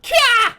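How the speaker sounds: very high, loud screech call; shrill shriek. Acted. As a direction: screech it out at full volume. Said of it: "please do not repeat that sound".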